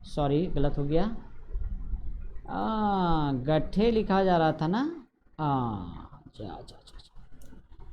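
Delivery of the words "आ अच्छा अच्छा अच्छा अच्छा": hushed aside. Real, not acted. Teacher notices mistake quietly.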